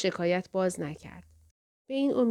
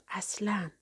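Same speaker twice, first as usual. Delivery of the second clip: a quiet aside, dropping the voice; under her breath to herself; 9 dB quieter than usual. A narrator's reading. Nanny answering herself privately, under her breath. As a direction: keep it to yourself, barely voiced. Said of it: "با خودم گفتم".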